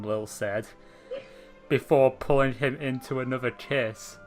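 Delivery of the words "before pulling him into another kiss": ordinary delivery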